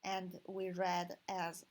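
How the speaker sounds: normal talking voice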